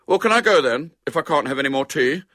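high, loud, hurried schoolboy plea; put-out small boy; petulant. A narrator's reading. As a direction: bargain sulkily to be let go. Boy bargains with his mother.

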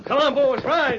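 loud high panicked yell; shouting. Acted. Outlaws fleeing.

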